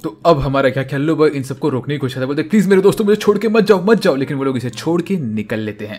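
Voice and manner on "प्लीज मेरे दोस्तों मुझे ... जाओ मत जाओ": higher, louder plea not to be left behind; desperate pleading. Acted. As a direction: call after them, begging, higher and louder. Yellow boy begs leaving friends. (ऊँची और ज़ोरदार आवाज़ में गिड़गिड़ाना)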